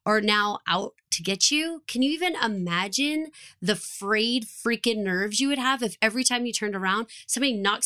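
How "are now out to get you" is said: normal talking voice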